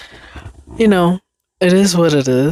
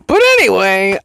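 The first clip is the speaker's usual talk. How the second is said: loud, high-pitched, hammed-up segue; self-mocking exaggeration; 6 semitones higher, 5 dB louder than usual. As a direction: blurt it higher and louder, mocking your own catchphrase. Mocks own repeated filler.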